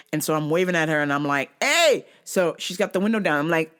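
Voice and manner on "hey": shout. Retold or imitated. Yelling at driver.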